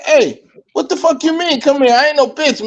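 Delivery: high-pitched replay of bristling at a stranger; retold offended challenge. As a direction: push back indignantly, in storytelling mode.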